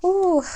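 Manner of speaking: sudden high, loud ooh of awe; a startled, overwhelmed ooh; surprised ooh. Real, not acted. Reading moves her, gasps.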